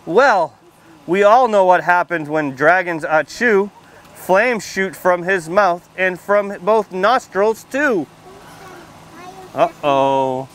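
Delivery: put-on lively storybook read-aloud for little kids; reading to children. A narrator's reading. Firefighter reading a picture book to kids at story time, lively read-aloud. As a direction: read it out bright and clear for the children.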